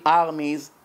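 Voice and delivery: usual speaking voice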